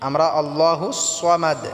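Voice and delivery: ordinary delivery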